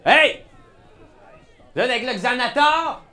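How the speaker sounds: loud, high yell; yelling across the room. Acted. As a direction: shout it across a noisy bar. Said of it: "fait juste crier à travers la pièce".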